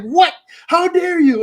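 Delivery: high, loud, hammed-up outrage; mock indignation. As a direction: gasp it out, high and offended. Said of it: "I was like".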